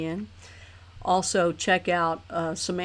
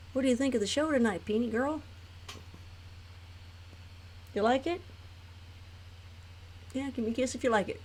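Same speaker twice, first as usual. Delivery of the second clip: quick high soft coo; 5 semitones higher, 7 dB quieter, faster than usual. Real, not acted.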